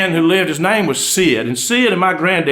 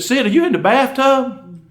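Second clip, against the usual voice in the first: incredulous; 7 semitones higher than usual. Acted. Wife questions husband incredulously.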